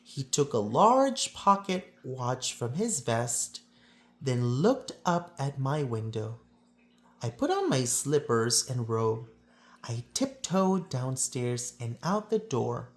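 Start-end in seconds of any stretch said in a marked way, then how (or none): none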